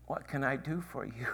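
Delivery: whispered, soft question to the beggar; gentle whisper. Acted. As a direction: ask it in a hush, kindly. Jesus speaks gently to beggar.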